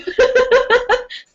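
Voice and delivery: high, bright laugh bursting out; burst of laughter. Real, not acted. Laughs at her own joke.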